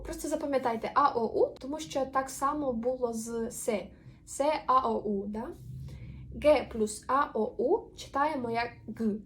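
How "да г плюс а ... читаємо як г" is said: usual speaking voice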